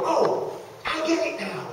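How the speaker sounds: high, soft "oh" of sudden understanding; dawning realization. Retold or imitated. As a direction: act out the light bulb going on. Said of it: "the light bulb goes on for them".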